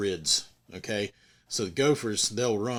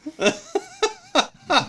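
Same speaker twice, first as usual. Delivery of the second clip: high laugh; 5 dB louder than usual. Real, not acted. Narrator laughs again.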